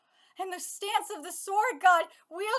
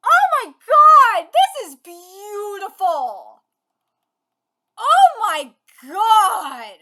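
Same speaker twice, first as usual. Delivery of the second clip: awed; 13 dB louder, slower than usual. Real, not acted. Awed by beautiful art.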